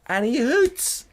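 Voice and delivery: high, indignant hoots; outraged disbelief. Real, not acted. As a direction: spit out the outrage. Outraged retelling of driver.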